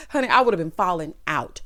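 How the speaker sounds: ordinary delivery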